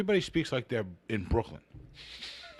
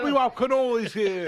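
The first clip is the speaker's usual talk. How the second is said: Brooklyn wise guy; 10 semitones higher, 5 dB louder than usual. Acted. Brooklyn character bit.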